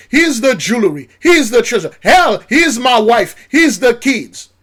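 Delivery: pleading elite. Pretend. Groveling billionaire begging the preacher's people, loud. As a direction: beg like a desperate rich man.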